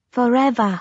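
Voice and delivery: ordinary delivery, nothing marked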